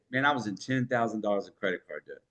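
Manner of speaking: low-key storytelling take; casual confessional. Shown as a demonstration. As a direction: tell it like a quiet personal admission. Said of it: "Or I could say".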